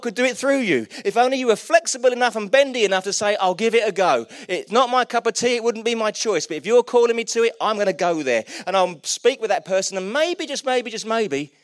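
high, loud, driving preacher delivery; rousing sermon climax, loud and urgent; impassioned preaching. Real, not acted. Preaching at full force.

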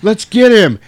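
high, loud rallying yell; rally them with a hammed-up shout; mock battle cry. Alan urges brothers to attack Laddie, loud yell. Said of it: "And Alan goes".

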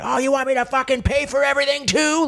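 sarcastic high-pitched retort at a heckler; mock angry outburst. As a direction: fire it back like a fed-up man mid-divorce. Retold snapping at heckler in divorce.

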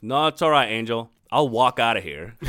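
imitating a cheeky young man turning down help; mock defiant. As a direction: brush off the offer of help with cocky pride. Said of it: "he looks at me and he goes".

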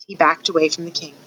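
ordinary delivery, nothing marked

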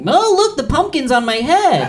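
very high, loud, cutesy voice; mock cutesy. Man hamming it up for a date with a pumpkin, high and cutesy. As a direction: play the adorable date.

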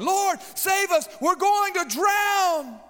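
high, slow desperate cry; panicked scream. Retold or imitated. Frightened disciples calling out to Jesus, panicked scream. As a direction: cry out like sailors about to drown. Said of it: "Now they're screaming and crying".

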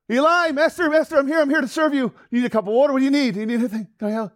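high, loud, breakneck offers of help; frantically eager servant. Acted. Boy rushes to his sleeping master, frantically eager. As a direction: fall over yourself to serve.

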